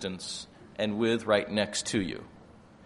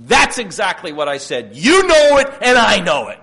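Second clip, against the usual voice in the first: loud, high sermon delivery; full-force preaching; 9 semitones higher, 13 dB louder than usual. Acted. Preacher telling how God calls Adam out, booming. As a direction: preach it out over the room.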